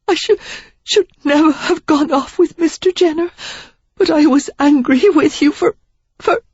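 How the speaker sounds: high, breaking, tearful; stumbling through sobs; tearful young woman. A narrator's reading. Sarah sobbing her confession to Derek.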